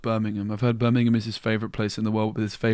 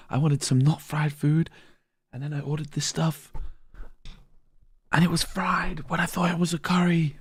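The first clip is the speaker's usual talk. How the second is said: moaning; 6 semitones higher than usual. Retold or imitated. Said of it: "Those classic Moyan getting mad".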